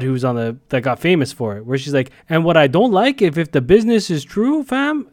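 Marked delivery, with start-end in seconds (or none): 2.3-5.1 mocking Toronto accent, rising high on "fam". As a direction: imitate a Toronto slang talker. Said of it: "I've seen her talk normally".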